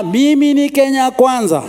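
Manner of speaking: hammed-up declaration of party loyalty; mock party supporter. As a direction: hold the slogan out like a rallying cry. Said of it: "it's like somebody who is saying".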